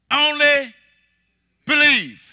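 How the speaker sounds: Only believe, called out high and loud with a falling close; a ringing command to believe; loud proclamation. Acted. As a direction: proclaim it, each word on its own. Jesus' words, proclaimed to Jairus.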